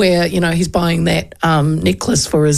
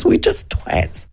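whispered aside; 11 semitones higher, much slower than usual. Real, not acted. Aside about Aucklanders.

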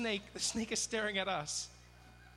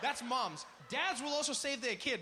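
ordinary talk, nothing marked

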